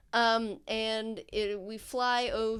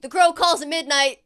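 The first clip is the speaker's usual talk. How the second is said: louder, higher mock-solemn password call; 5 semitones higher, 10 dB louder than usual. Fingers calling through the door, louder mock password. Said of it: "a very familiar voice go".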